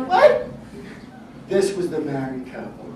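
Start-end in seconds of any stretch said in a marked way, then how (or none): 0.1-0.5 a very high, loud "What?"; shocked. Retold or imitated. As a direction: blurt it out in disbelief, way up high. Fireman's shock at the news.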